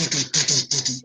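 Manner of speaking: rapid-fire mouthed drum beats; vocal drum fill; imitated drum roll. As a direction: rattle off drumming with the tongue. Imitates drum roll after catching stick.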